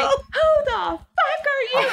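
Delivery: very high, drawn-out mock outrage; retold outraged retort. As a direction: act out a shrieky comeback to a husband. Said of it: "And I was like".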